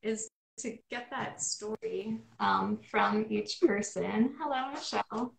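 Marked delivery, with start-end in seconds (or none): none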